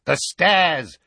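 urgent sudden cry; cried out. A narrator's reading. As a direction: shout the idea at once. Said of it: "cried Dawlish".

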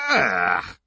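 loud far-off groan of disgust; disgusted ugh. Acted. Ron opens Kreacher's smelly cupboard, disgusted loud ugh.

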